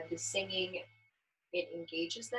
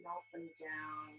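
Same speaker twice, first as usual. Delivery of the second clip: soft soothing voice; 2 semitones lower, 11 dB quieter than usual. Shown as a demonstration. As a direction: melt the words down softly like the touch. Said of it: "very softly".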